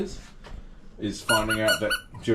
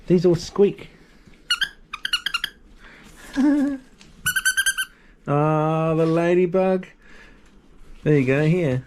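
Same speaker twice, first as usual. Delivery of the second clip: talking to a dog; 12 semitones higher, slower than usual. Real, not acted. Offering dog a toy.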